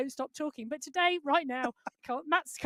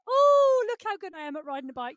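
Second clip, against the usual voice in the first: mock boasting; 5 semitones higher, 5 dB louder than usual. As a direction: imitate a commentator showing off. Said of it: "I love it when the commentary team start to say".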